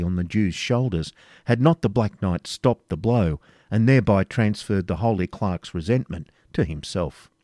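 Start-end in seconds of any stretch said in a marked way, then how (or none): none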